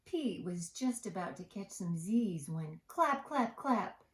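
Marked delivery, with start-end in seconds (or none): none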